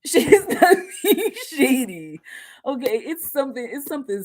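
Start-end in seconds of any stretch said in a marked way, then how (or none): 0.1-2.2 slower, louder, laughing through the words; laughing repeat. Real, not acted. Reader insisting to the client that the friend means well, laughing. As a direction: say it again dissolving into laughter.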